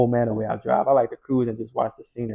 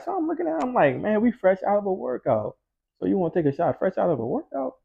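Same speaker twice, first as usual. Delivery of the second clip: pitched way up in disbelief; can't believe she wants a shot; incredulous; 8 semitones higher than usual. Retold or imitated. Teller asking the girl about a shot, incredulous and high.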